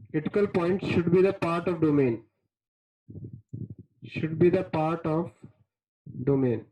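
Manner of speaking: very slow, deliberate dictation pace. Acted. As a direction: spell it out slowly for notes. Teacher dictates definitions slowly.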